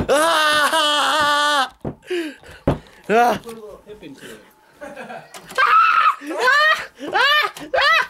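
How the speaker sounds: high frightened screams; screaming. Pretend. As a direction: shriek as if chased. Screaming in pretend fear.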